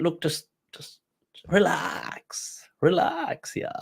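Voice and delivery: soft, hushed "relax"; calm someone down, dropping toward a whisper; acted gentle hush to settle an argument; soothing. Bystander soothing the arguing official.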